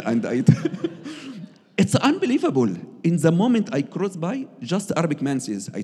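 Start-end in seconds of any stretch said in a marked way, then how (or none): 0.5-1.5 high burst of real laughter; a real, joyful laugh of amazement; delighted laugh. Real, not acted. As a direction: laugh out in happy disbelief. Laughing at the unbelievable moment.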